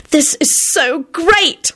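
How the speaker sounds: high, drawn-out gushing; slow, high-pitched fervour; fervent. A narrator's reading. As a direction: gush it with heartfelt slowness. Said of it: "Rosie told them fervently".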